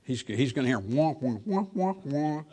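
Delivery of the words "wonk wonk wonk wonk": muted trumpet wah-wah imitation, jumping high; Charlie Brown adult voice. Preacher mocking what the unsaved hear, trombone wah-wah. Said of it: "another rerun of Charlie Brown's Christmas".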